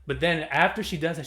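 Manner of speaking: usual speaking voice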